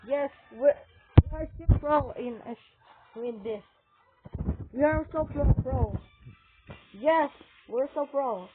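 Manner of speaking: loud, triumphant gamer boasting; excited cheering. Real, not acted. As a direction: celebrate the win loudly. Gamer celebrating a win.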